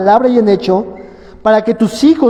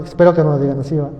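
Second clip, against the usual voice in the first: ordinary delivery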